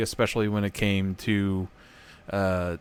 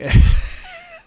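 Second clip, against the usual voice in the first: squeaky burst of laughter; high squeal of laughter; 11 dB louder than usual. Real, not acted. Laughs after the impression.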